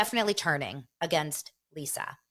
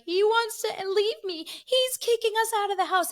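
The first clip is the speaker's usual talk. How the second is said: hammed-up sobbing quote, much higher; imitating crying; 4 dB louder than usual. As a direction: whimper it like a weeping wife. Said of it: "she's crying and she's like".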